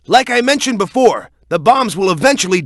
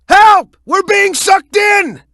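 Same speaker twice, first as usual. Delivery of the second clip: high, loud desperate shout; panicked cry for help; 11 semitones higher, 5 dB louder, slower than usual. Acted. Ally screaming for help while being sucked into the vortex, panicked high shout. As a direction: yell as you get dragged away.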